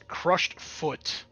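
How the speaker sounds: usual speaking voice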